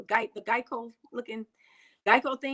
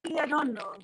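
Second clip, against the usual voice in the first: put-on African accent, pitched up high; 7 semitones higher than usual. Shown as a demonstration. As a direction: show off your mock African accent. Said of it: "I put on my African accent".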